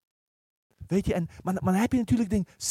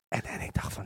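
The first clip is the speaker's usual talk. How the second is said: whisper; 10 dB quieter than usual. Real, not acted. Preacher recalls doubting late call.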